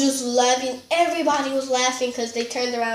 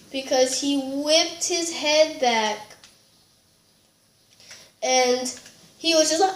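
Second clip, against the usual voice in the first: normal talking voice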